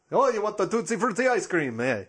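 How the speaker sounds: put-on playful Italian accent. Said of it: "now it's also with an Italian accent".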